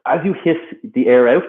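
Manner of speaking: ordinary delivery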